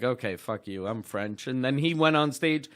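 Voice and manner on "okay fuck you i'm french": low, muted retort; resigned. Retold or imitated. Comic snubbed by Cosby after explaining, quietly resigned. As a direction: give up under your breath, lower and softer.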